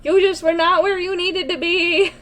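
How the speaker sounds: slow, high, soothing reassurance; calm her little one slowly. Real, not acted. Soothing the baby.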